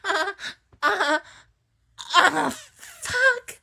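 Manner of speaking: very high, loud cries; peak of climax. Acted. At the peak with her partner, very high loud cries. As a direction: cry out at the top of the climax.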